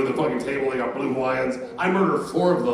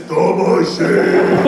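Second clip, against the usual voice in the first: loud drawn-out stage-name bellow; mock announcing; 3 semitones higher, 11 dB louder, much slower than usual. Kid tells his mom the comedian's nickname, mock announcing bellow. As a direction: stretch it out like a big nickname reveal.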